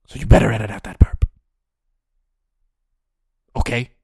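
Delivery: hammed-up furious boss; loud mock threat. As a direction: shout the order at the editor. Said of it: "final threat".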